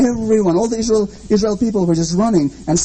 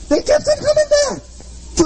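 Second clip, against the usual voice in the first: incredulous. Acted. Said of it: "emphatic incredulity".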